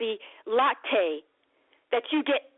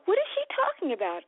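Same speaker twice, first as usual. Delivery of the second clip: confused patient; 6 semitones higher, faster than usual. Retold or imitated. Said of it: "and you'll go".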